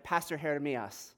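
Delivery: imitating a friend's Brazilian accent; light mock Brazilian accent. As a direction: Brazilian Portuguese lilt, J softened to an H. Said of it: "likes to say in her Brazilian accent".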